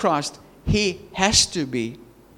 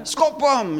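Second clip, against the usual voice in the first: lightly mimicked crowd, pitched higher; egging them on; 7 semitones higher than usual. Preacher voices crowd at a street fight, goading. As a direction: imitate a spectator goading fighters. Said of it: "the crowd was egging them on".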